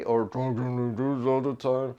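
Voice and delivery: pressed, unnatural voice; low pressed voice. Shown as a demonstration. Teacher demonstrating a forced unnatural voice to students. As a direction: show a forced voice that isn't yours.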